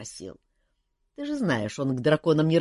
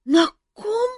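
high, loud startled cry; startled girl; 8 dB louder, slower than usual. A narrator's reading. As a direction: jolt awake in alarm. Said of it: "переспросила Ольга".

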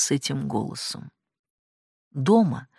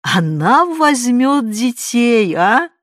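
insinuating exclamation; 8 semitones higher, 7 dB louder, much slower than usual. A narrator's reading. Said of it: "вкрадчиво воскликнула она".

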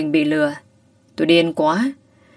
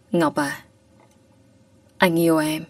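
soft, quiet love confession; tender confession; 4 dB quieter than usual. A narrator's reading. Teacher softly confessing love to Ngọc, tender and quiet. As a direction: say it gently, almost shy.

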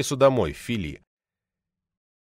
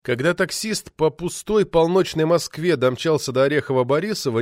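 ordinary delivery

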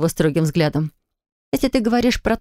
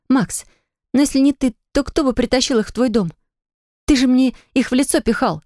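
Polina voice; 6 semitones higher than usual. A narrator's reading. Polina, higher voice figure.

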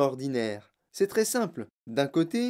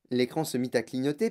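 ordinary delivery, nothing marked

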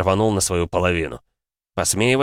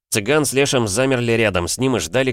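usual speaking voice

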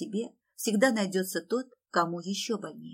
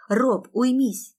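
high, loud, drawn-out plea; slow, raised yell; shouting; 5 semitones higher, 6 dB louder, much slower than usual. A narrator's reading. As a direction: cry out to calm your brother down. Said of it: "крикнула я".

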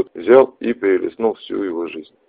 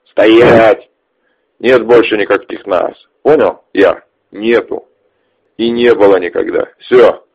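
angry; 3 semitones higher, 8 dB louder, slower than usual. Acted. Ches yells, waving the gun.

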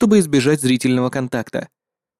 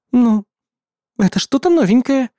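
high, genuinely puzzled; 9 semitones higher than usual. A narrator's reading. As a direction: sound truly baffled. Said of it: "в искреннем замешательстве".

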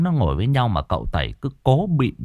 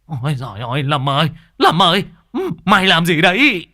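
high cry building louder; howling in alarm; 8 semitones higher, 4 dB louder than usual. Acted. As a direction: yelp out in startled alarm. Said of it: "Lão rú lên".

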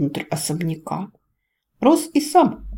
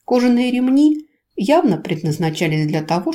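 ordinary delivery, nothing marked

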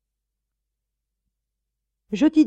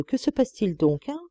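usual speaking voice

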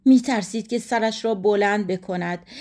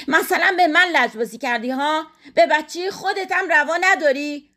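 higher-pitched, reproachful challenge; indignant wife; 7 semitones higher than usual. A narrator's reading. Wife after the will is burnt, indignant. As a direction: challenge him in the wife's voice.

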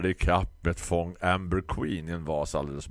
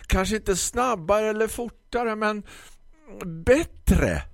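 high, loud, slow condescending shop lady; grumpy florist; 4 dB louder, slower than usual. Acted. Florist lectures the customer about ordering online, high condescending voice. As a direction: lecture him like a slow child.

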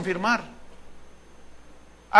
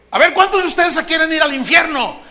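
louder, higher preacher call; preacher baiting the congregation; loud trick question; 7 semitones higher, 8 dB louder than usual. Retold or imitated. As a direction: boom the question at the crowd.

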